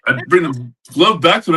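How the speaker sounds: ordinary delivery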